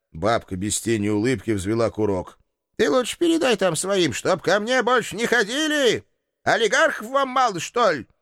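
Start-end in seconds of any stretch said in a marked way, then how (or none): 2.8-8.1 put-on much higher old crone's scolding; shrill-free high old woman's rebuke; old landlady. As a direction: shoo him off like a cranky granny. Landlady warns hero.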